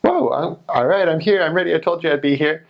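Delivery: high, proud announcement; eager church lady. Retold or imitated. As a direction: announce you're here, up high. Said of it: "she's like".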